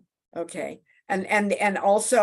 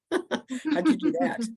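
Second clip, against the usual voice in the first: laughing question; 7 semitones higher than usual. Real, not acted. Amused by on-screen heart animation.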